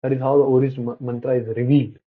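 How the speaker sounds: usual speaking voice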